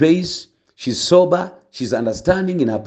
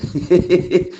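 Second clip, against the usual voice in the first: light laugh; 4 semitones higher than usual. Real, not acted. Laughs at own story.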